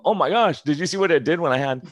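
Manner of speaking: high, loud gasping amazement; imitating a stunned patient, high and loud; excited patient. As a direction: blurt it out in shock at the number. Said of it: "People go".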